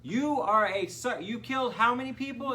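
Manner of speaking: mock incredulous confrontation. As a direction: imitate a man stunned at who he sees. Same incredulous man acted out.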